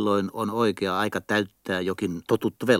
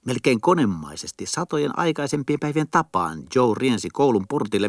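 ordinary delivery

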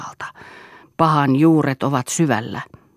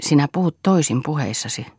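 ordinary delivery

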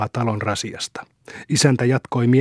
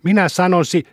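wife getting heated, higher and louder; heated, raised-pitch insistence; escalating; 7 semitones higher, 5 dB louder than usual. A narrator's reading. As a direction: push on louder until cut off. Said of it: "mutta rouva yltyi".

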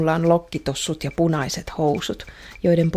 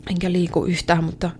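normal talking voice

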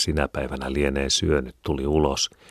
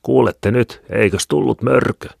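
high, loud taunting warning; raised-voice old wife scolding; old women's warning; 8 semitones higher, 7 dB louder than usual. A narrator's reading. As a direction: scare the children with the bogeyman. Old women warn the children.

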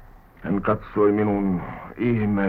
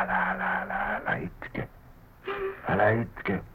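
soft, half-whispered hushing; hushed consoling; 11 semitones higher, 9 dB quieter than usual. Acted. Consoling the weeping servant, hushed. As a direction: quieten them under your breath.

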